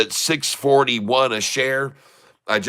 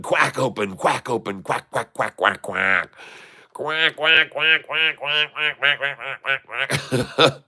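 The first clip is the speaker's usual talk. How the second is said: duck quacks; 2 semitones higher, slower than usual. Pretend. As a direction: quack along with the chart. Imitating a duck call.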